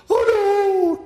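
high, loud frightened Oh no; mock fright. Shown as a demonstration. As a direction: squeal it like a scared crowd. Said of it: "Can you act really afraid?".

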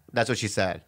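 ordinary talk

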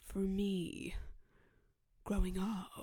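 lightly voiced pleading son, partly whispered; slow, breathy plea to dad; a hint of a hurt son, half-whispered; showing how it's done. Shown as a demonstration. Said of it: "It's like this".

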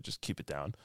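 low, soft retold request; mock subdued. Retelling the sympathetic housemate giving in, low quiet voice. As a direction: drop right down to a murmur.

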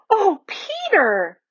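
high, drawn-out cry of wonder; little girl's amazed, slow exclamation; exclaimed. A narrator's reading. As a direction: exclaim it slowly, pitched far up. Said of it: "exclaimed Lucy".

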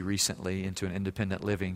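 ordinary talk, nothing marked

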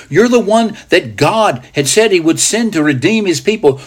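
high, ringing declaration of faith; Peter's great confession. Acted. Disciple confesses the Messiah to Jesus, fervent proclamation. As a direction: proclaim it with rising fervour. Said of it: "Peter makes the great confession".

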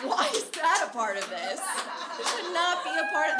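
mock-outraged notes; laughing rant. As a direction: scold her editing. Ranting about cropping the printer.

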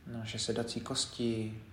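quiet, unhurried meditation leader; guided meditation. Acted. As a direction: guide them gently through the body, leaving space between phrases. Leads a body scan meditation.

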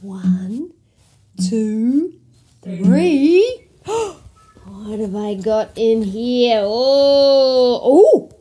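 ordinary delivery, nothing marked

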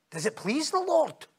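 high, swooping challenge; pointed question. Retold or imitated. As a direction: ask it high-pitched, like checking on someone. Questioning in an acted dialogue.

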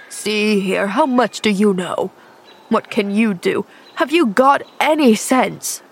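a touch of an impatient man in pain; gruff injured man. Acted. Injured man curtly quizzing the girl, mildly impatient.

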